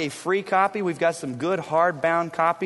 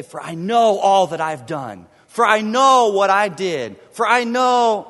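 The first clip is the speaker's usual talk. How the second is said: "know" pushed loud in a mocking boast; preacher mocking a self-reliant believer, loud; 7 dB louder than usual. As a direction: play the proud man listing his deeds. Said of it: "he doesn't say".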